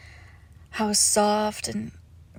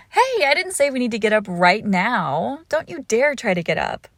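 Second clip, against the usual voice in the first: playful scolding; 7 dB louder than usual. Acted. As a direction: scold your pet playfully, voice jumping up. Playful scolding of cuddling pet.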